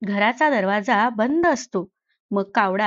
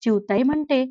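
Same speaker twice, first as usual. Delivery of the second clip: ordinary delivery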